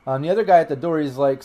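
ordinary talk, nothing marked

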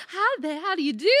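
put-on high, loud caricature of a Southern accent; mock Southern accent. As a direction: ham up a Southern greeting to make fun of it. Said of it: "Southern accents don't actually exist".

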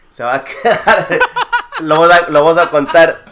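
laughing. Real, not acted. Teasing listeners about untold trip stories, laughing aloud. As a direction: giggle out the promise of untold stories. Joking secrets stay off recording.

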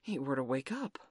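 slightly husky, hesitant suggestion; mildly husky worry; a little husky. A narrator's reading. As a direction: put a touch of husk in the voice. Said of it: "John said a little huskily".

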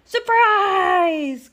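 high, loud drawn-out shout; spring it on them with a long wail; wolf's big pouncing cry; gleeful cry. A narrator's reading. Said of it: "cried the wolf".

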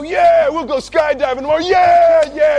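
put-on loud drunken cheer; excited. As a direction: shout yeah along with the crowd. Drunk agreeing to skydive.

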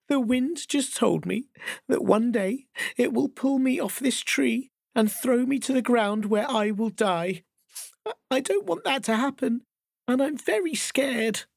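high small frightened leaf voice; scared little leaf, close to tears; tearful little leaf. Acted. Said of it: "said with a big sniffle".

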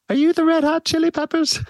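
very high-pitched impersonated boxer voice; Mike Tyson. Retold or imitated. Boxer answering the band in the hotel lobby, high-pitched impression. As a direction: put on a high little voice for the question. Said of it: "Mike's answer was".

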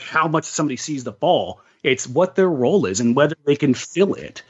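ordinary talk, nothing marked

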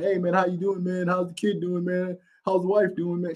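a touch of mock-friendly high voice; mildly mocking sweet greeting; fake friendly greeting. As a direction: imitate a fake-nice relative. Mock cousins calling back.

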